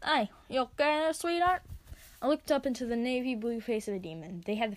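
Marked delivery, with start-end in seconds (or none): 0.0-1.6 gruff bark. Acted. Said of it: "A gruff voice barked".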